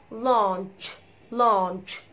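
"لانش" stretched, ending in tch; correct lunch. Shown as a demonstration. Teacher drilling the right word, "لانش" ending tch. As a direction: say "لانش" slowly with a clear final ch. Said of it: "فهي اسمها".

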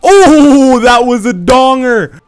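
high, loud victory yell; a drawn-out, stretched-out celebratory yell; hype shout. Real, not acted. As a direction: scream it out like you just hit the shot of the game. Big kill celebration shout.

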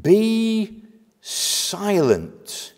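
slow, drawn-out high command; stern rebuke. Acted. Jesus rebuking the demon, slow commanding rebuke. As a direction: stretch each word into an order. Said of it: "rebuked him, saying".

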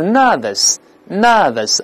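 British nervous, no r in either syllable; British pronunciation. Shown as a demonstration. Teacher shows British reading, British nervous without any r. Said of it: "英式发音是都不卷舌".

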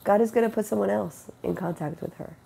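ordinary delivery

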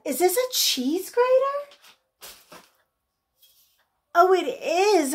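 delighted high-pitched question; excited surprise. Real, not acted. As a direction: squeal the discovery, drawing it out. Excited discovery while unboxing.

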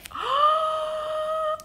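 long, high gasp of delight; excited gasp. Real, not acted. Showing viewers a new candy bar, excited gasp.

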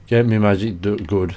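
normal talking voice

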